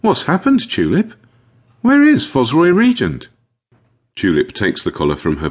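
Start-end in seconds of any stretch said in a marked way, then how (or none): 0.0-3.3 high, worried questioning; anxious high-pitched questions; frowning young caretaker. A narrator's reading. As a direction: press for an answer in a high, concerned voice. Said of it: "She frowns and asks".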